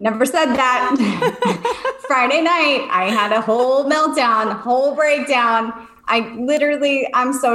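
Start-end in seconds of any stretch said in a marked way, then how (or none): 1.0-2.0 high laugh. Real, not acted. Laughing at herself.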